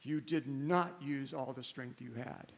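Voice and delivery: gentle father. Acted. Father gently corrects his son.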